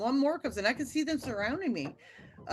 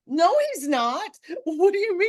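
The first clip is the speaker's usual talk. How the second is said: high, loud frantic disbelief; a scared mother arguing with the dispatcher, frantic; panicked protest; 8 semitones higher, 6 dB louder than usual. Retold or imitated. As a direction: shout it in rising panic.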